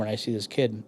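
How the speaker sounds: ordinary delivery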